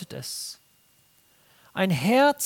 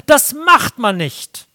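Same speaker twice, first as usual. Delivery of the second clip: loud, slow, scolding rule; loud, slow self-righteous verdict; stern loud rule; 5 semitones higher, 11 dB louder, slower than usual. Retold or imitated. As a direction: bark out the moral rule. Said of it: "dass ich sage".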